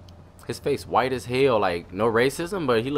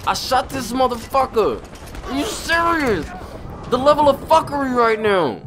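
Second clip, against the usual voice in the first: incredulous; 11 semitones higher than usual. Real, not acted. Disbelief at game going wrong.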